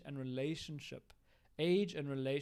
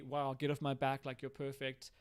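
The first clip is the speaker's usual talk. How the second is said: ordinary delivery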